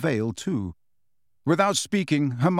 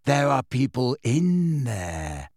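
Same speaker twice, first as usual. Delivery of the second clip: dreamy; slower than usual. A narrator's reading. Luna dreamy by the veil.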